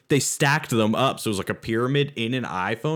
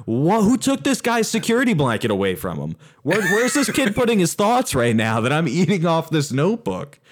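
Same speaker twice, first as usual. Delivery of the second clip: high, incredulous rant; mock outrage; 5 semitones higher, 3 dB louder than usual. Customer protesting to a restaurant about plates, mock outrage. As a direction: pitch it up in indignant disbelief.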